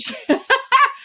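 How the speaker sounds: high, loud real laugh; sudden high laugh; burst of laughter. Real, not acted. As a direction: laugh out at herself. Laughs at her own story.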